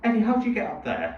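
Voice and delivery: high-pitched, baffled question to a pet; acted-out bewilderment, pitched well up. Owner to big dog on windowsill, baffled questioning.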